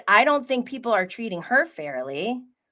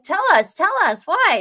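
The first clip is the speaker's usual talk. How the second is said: eager pleading; 11 semitones higher, 6 dB louder than usual. Retold or imitated. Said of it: "And they're all like".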